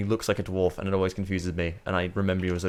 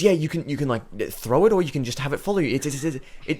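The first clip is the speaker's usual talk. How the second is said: high, eager stammer building louder; keen little official getting carried away; enthused gatekeeper; 8 semitones higher, 5 dB louder than usual. Acted. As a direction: pitch your pet idea with excitement. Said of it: "really enthused about his idea".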